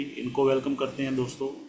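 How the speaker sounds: ordinary talk, nothing marked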